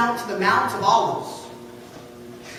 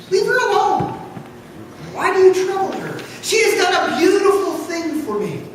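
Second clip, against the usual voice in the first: higher, firm rebuke; Jesus defending her; 6 semitones higher than usual. Acted. Jesus rebukes the grumblers who scold the woman, firm. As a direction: shield her from their scorn.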